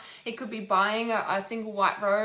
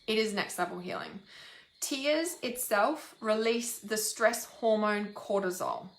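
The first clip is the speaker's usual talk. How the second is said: ordinary talk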